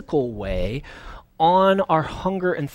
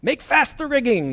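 high-pitched shipboard command; imitated pirate yell; 10 semitones higher, 4 dB louder than usual. Quoting a sailor's expression to the congregation, high mock yell. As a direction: call it out like a sailor on deck. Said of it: "somebody yell".

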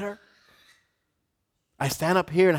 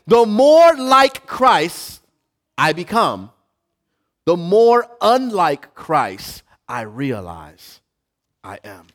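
slow, weighty restatement with punched more; emphatic repeat; 5 dB louder, slower than usual. Shown as a demonstration. Pastor repeating for the congregation, slow and emphatic. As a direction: drive the point home slowly. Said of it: "I'm gonna say it one more time".

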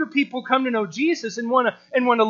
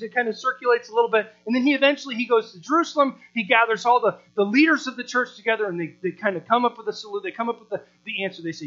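ordinary delivery

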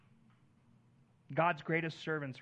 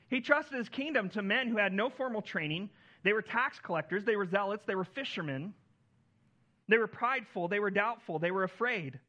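louder, higher preacher's list, each beat hit; preaching at full force; 5 semitones higher, 6 dB louder than usual. Acted. Pastor listing the disciples' flaws, loud and rising. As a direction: build the list up from the pulpit.

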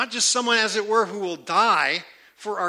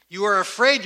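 ordinary talk

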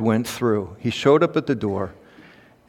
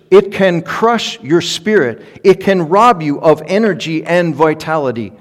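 louder, higher, driving sermon cadence; preaching at full force; 4 semitones higher, 5 dB louder than usual. Acted. Pastor warning his church about fear, full preaching voice. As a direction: press it home to the congregation.

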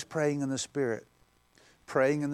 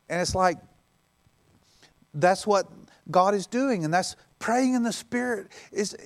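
higher, pressing preacher voice; fiery preaching; 8 semitones higher, 6 dB louder than usual. Acted. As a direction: drive it home from the pulpit. Preacher at full force.

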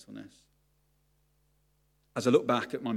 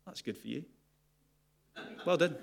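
unimpressed; 5 semitones higher than usual. Retold or imitated. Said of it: "did I react like this?".

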